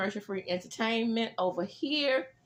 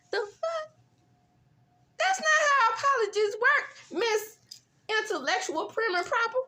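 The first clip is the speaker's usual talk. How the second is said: much higher, louder, exasperated; indignant outburst; 4 dB louder than usual. Real, not acted. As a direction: blast the apology line in disbelief. Outraged reaction to the apology remark.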